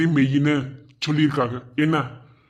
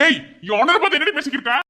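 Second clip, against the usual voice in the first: angry rebuke; 11 semitones higher than usual. Acted. Man rebukes wife.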